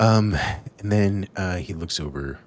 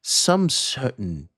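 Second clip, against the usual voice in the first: musing foreman; 5 semitones higher, 7 dB louder, much slower than usual. Acted.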